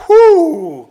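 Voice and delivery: long, high, loud whew; relieved whew. Retold or imitated. Snowboarder relieved the guy never came, loud whew. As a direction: let out a big drawn-out whew.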